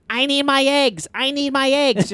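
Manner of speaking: high-pitched, insistent imitation of an egg-obsessed old lady; brutal egg lady voice. Podcaster imitating the film's egg lady for co-hosts, brutal voice. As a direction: mimic her demanding voice, pitched well up. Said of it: "brutal voice where she's like".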